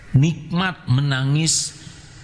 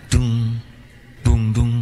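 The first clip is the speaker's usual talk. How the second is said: thumping noise; 5 semitones lower, 5 dB louder, slower than usual. Retold or imitated. Imitating blocked ear thumping.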